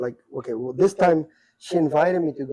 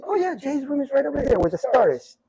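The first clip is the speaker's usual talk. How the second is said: cleaning lady; 10 semitones higher than usual. Retold or imitated. Cleaning lady voiced in story.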